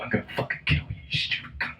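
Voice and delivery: hissed under the breath; menacing whisper. Retold or imitated. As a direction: threaten in a whisper. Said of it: "you're like".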